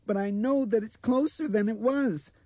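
put-on very high, loud child's voice; insist, high and scared; frightened little girl. A narrator's reading. Blind girl warning it is closer, frightened.

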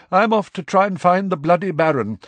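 fed up and off to fix it; exasperated. A narrator's reading. Nick excited, exasperated.

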